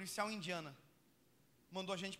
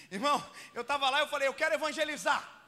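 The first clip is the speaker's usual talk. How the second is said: loud, high preaching burst; fervent preaching shout; 6 semitones higher, 10 dB louder than usual. Real, not acted. Preacher shouting to the congregation about his mission. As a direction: proclaim it to the church.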